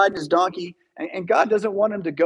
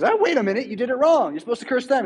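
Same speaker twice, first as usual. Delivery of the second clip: higher, exasperated king's voice; a king correcting a blundering hireling; flustered king; 6 semitones higher, faster than usual. Retold or imitated. King scolds Balaam for cursing wrong, higher and exasperated. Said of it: "The king says".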